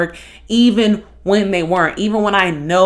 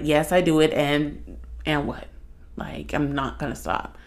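subdued retold admission; 4 semitones lower, 8 dB quieter than usual. Retells relative's phone admission.

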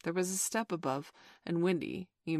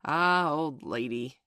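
loud, slow, drawn-out greeting; hearty father; 7 dB louder, slower than usual. A narrator's reading. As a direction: greet her grandly as man of the house. Peter greets Wendy playing father.

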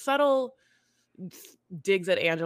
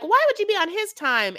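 incredulous outburst; 12 semitones higher, 8 dB louder than usual. Retold or imitated. Everyone reacting in disbelief.

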